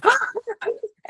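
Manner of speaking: high, startled intake of breath; re-enacted shocked gasp; sharp gasp. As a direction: gasp as if suddenly thrown. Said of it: "I remember vividly going".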